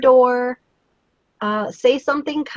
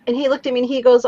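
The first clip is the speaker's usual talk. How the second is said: ordinary talk, nothing marked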